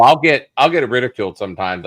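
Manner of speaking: ordinary talk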